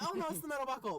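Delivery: mock-polite correction to the agent, pitched high. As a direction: chirp it falsely nice. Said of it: "I'm just being like".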